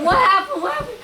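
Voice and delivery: high, insistent imitation of panic; alarmed question. Friend asking why she is crying, imitated alarm. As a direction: press for an answer in mock alarm.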